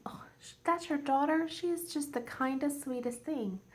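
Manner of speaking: high, sweet imitation of an admirer; gushing praise. Voices someone praising her daughter.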